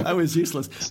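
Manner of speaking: laughing through the words at his own story. Real, not acted. Host laughing at his failed flute lessons, laughing. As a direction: chuckle it out, self-mocking.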